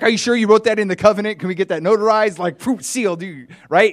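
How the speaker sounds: high, loud, fast eager questions; eager disbelief. Acted. Chad pressing the billionaire for notarized proof, high and fast. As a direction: press hard for proof, can't believe it.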